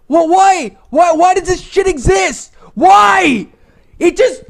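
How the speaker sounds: ordinary delivery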